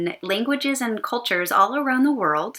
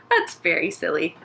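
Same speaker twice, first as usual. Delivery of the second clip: normal talking voice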